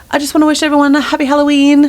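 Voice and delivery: high, bright put-on Gaga voice, a bit fast; [name] voice. Wife mimicking [name] addressing the concert crowd, high put-on voice. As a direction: mimic a pop star greeting her crowd. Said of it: "giving her that voice".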